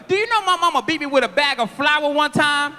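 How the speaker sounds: ordinary talk, nothing marked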